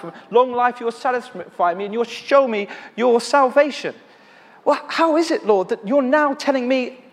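climbing protest to God, piling up verses; rising indignant plea. Retold or imitated. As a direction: build from pleading to near outcry. Arguing scripture back to God.

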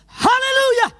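high, loud burst of hallelujah; sudden hallelujah. Retold or imitated. Preacher recalls blurting out in the lift, high loud hallelujah. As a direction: blurt it out like a shout of praise. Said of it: "the hallelujah just came out of my mouth".

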